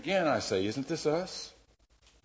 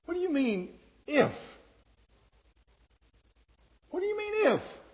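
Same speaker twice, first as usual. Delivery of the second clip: much higher, incredulous mock challenge; sarcastic retort. Jesus challenging the doubting father, high and sarcastic. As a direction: toss the question back, amused. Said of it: "Or at least a sarcastic humor".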